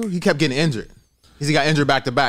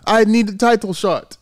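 imitated listless, accented fighter; mock no enthusiasm; 5 semitones higher than usual. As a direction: drag it out like a half-asleep fighter. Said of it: "he don't have no enthusiasm".

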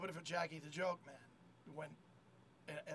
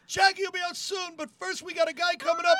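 high, loud stage announcement; emcee shout; 10 semitones higher, 15 dB louder than usual. Retold or imitated. Kid telling crowd another guy is coming up first, emcee shout. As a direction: imitate an emcee hollering over the crowd.

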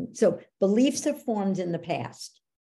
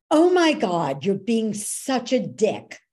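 higher, louder mock teenage snap; imitated teen outburst; 4 semitones higher, 6 dB louder, slower than usual. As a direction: play the exasperated fourteen-year-old. Teen daughter snaps at dad.

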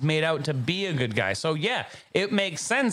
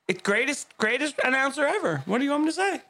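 higher, fired-up wrestling promo shout; Ric Flair impression; 9 semitones higher, faster than usual. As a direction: hype it up like a wrestler cutting a promo. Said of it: "I'm doing the Ric Flair, baby".

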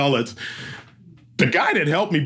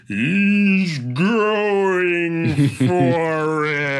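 very slow, higher, drawn-out announcer call; higher, slow-motion race call; announcer on the mic; 5 semitones higher, much slower than usual. Retold or imitated. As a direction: stretch it like a hype announcer. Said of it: "and I'm on the mic".

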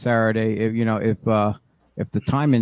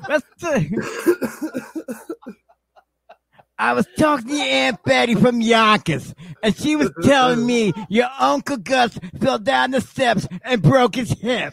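Yonkers mom; 8 semitones higher than usual. Pretend.

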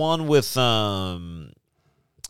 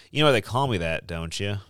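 ordinary delivery

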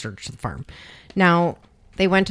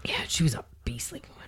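hushed whisper; 3 semitones lower, 8 dB quieter, faster than usual. Real, not acted. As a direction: whisper it like a secret. Spooky true crime story aside.

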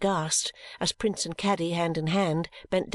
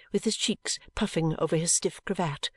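plain reading voice

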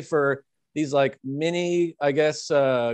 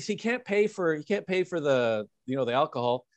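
ordinary talk

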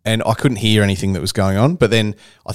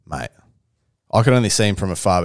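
ordinary talk, nothing marked

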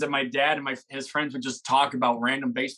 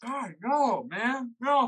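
pained high protest; 10 semitones higher, much slower than usual. Real, not acted. Reacting to spicy wing.

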